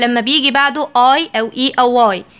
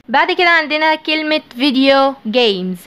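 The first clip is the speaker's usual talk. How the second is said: ordinary talk, nothing marked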